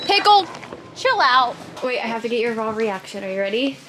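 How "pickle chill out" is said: calling out. Real, not acted. Calling the dog to calm down.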